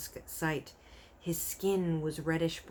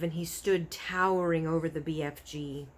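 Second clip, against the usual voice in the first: ordinary delivery, nothing marked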